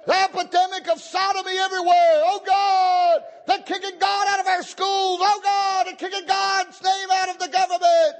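high, anguished pulpit shout; impassioned, high-pitched plea to God; crying out to God. Acted. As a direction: wail the prayer up to heaven at full force. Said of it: "Have you ever cried out to God".

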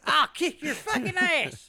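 put-on belligerent drunk. Playing redneck homeowner.

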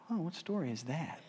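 softly puzzled, rising question; hushed musing question; wondering thought. Retold or imitated. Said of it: "And I thought".

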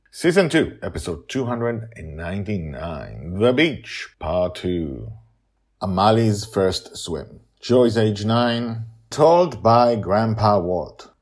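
loud, slow episode title read; title announcement. Acted. Podcaster announcing the episode title to listeners, loud and slow.

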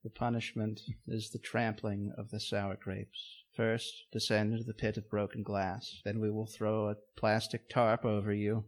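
low, quiet grim sentencing; solemn ritual judge. Acted. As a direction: pronounce doom like a cult elder.

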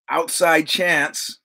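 ordinary talk, nothing marked

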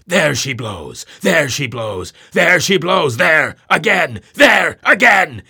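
lingering calls rising to a high shout; long-drawn cry. A narrator's reading. Ahab on the masthead, calling in long-drawn rising cries. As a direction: stretch each call with the whale's jets. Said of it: "in long-drawn, lingering, methodic tones".

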